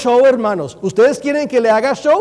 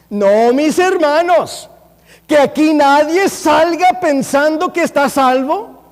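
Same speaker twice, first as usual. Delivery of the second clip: slow, loud, higher warning; emphatic preaching; 5 semitones higher, 3 dB louder, slower than usual. Real, not acted. Preacher warning the congregation, slow and forceful. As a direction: drive it home slowly.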